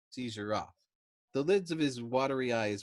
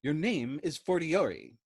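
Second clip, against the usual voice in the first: slow, louder contradiction; belligerent; 5 semitones higher, 5 dB louder than usual. A narrator's reading. Major overruling Yossarian, belligerent and slow. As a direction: shut him down with force. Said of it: "contradicted him belligerently".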